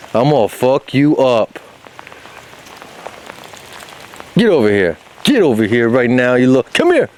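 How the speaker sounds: half-joking tough talk while chasing the dog; mock angry dog play. Real, not acted. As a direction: playfully pretend to scold her.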